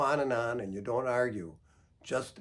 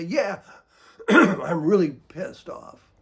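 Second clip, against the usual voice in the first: loud, slow, higher mock-angry agreement; 4 semitones higher, 11 dB louder, slower than usual. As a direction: throw it out like a fed-up partner.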